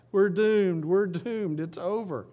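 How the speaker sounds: high, loud cry of mock despair; hammed-up hopeless alarm; acted panic. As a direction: wail it as a doomed servant, easing off at the end. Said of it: "You know, it's like".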